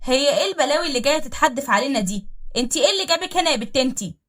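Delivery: jealous fury. Acted. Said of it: "عينيها مولعة من الغيرة". (صراخ غيرة واحتقار)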